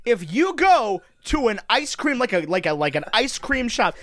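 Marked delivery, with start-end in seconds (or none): none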